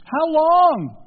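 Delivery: loud, high exasperated cry. Acted. Preacher echoing Jesus to the congregation, exasperated cry. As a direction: let it burst out in frustration.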